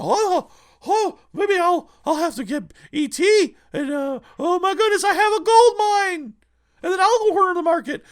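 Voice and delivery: high, loud gloating scalper; greedy scalper voice. Retold or imitated. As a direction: squeal like a greedy hoarder. Mocking a scheming scalper.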